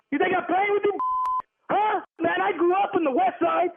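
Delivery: high, confrontational street bravado; hostile tone. Real, not acted. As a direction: challenge him, fed up and heated. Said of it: "you need to watch your tone".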